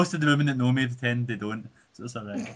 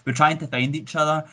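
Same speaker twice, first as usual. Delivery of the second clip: ordinary delivery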